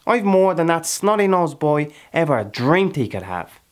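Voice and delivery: loud, high, impassioned boast; fierce boasting. Acted. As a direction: proclaim your hard-won riches at full voice. Character boasts heatedly of his land.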